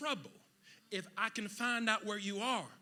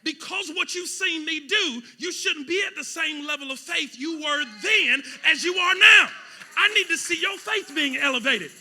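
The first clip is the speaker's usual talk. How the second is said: loud, high preaching; shouting sermon; full-force preaching; 6 semitones higher, 15 dB louder than usual. Acted. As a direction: preach it hard to the room.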